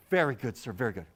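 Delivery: a hint of an apologetic server; polite waiter. Waiter accepting the order.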